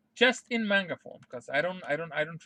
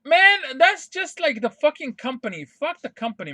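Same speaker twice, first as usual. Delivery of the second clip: frustrated outburst; 7 semitones higher, 8 dB louder than usual. Real, not acted. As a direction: let the anger burst out. Ranting outburst about the company.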